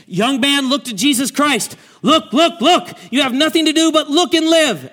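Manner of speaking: high and loud pulpit shout; shouted plea. Retold or imitated. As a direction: call out to the young man across the chapel. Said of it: "he shouted at the top of his voice".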